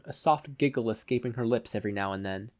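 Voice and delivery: plain reading voice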